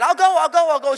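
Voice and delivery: high, loud eager repeats; mock excited daughter; excited child. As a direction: beg to come along like a thrilled kid. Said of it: "She was all excited".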